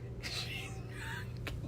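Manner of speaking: high, squealing laugh; shrill laugh. Real, not acted. Shrill laugh at Carrie Ann.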